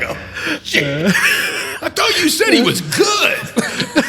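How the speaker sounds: high, loud and slow laughing cry; shocked shout. Retold or imitated. Lawyer acting a shocked client in a failing trial, laughing shout. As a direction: shriek it in disbelief. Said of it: "you're like".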